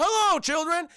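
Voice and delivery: high, loud, hearty greeting; Chef. Retold or imitated. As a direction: hail the kids in an imitated cook's hello. Acted Chef greeting the children.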